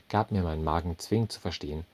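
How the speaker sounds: usual speaking voice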